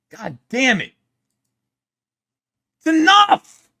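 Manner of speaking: loud, high yell of frustration; real shout. Real, not acted. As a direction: blow up and yell that it's enough. Frustrated yelling at clip.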